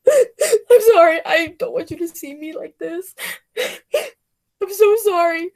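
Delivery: high, hammy fake sobs; mock sobbing. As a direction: pretend to break down crying. Fake sob after boos.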